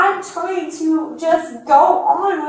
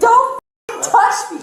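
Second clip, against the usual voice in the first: angry shout; 7 semitones higher than usual. Real, not acted. Arrest scuffle, resisting officers.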